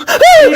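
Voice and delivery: high, loud surprised yelp; startled shriek. Acted. Mother startled by the courier, shrieking. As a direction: jump and shriek in fright.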